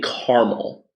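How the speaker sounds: caramel said as "CAR-mul"; two-syllable caramel. Real, not acted. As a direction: say caramel like "carmel", dropping the middle A. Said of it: "wrong in the way that you say".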